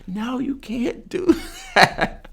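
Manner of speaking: slow, high retold protest broken by a laugh; laughing high yell. To the man slapping the injured guy, laughing high protest.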